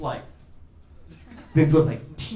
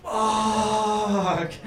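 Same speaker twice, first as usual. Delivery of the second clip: long, held, higher-pitched swear; mock dismayed curse; 5 semitones higher than usual. Surgeon realizes an hour's work must repeat, mock dismayed curse. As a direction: sustain the curse in comic despair.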